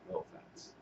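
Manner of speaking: under the breath, voiceless; a whispered no offense; whispered aside. Real, not acted. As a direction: slip it in as a hushed aside. Whispered aside about rednecks.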